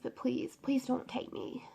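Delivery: soft, slowing plea; retold pleading prayer. Retelling her prayer not to die, softly pleading. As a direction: beg God quietly, as if recalling it. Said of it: "and I'm praying".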